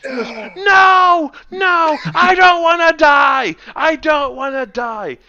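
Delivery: high, loud, slow panicked screaming; terrified Norin. Acted. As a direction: scream like you are fleeing death. Norin panics, dwarf falls.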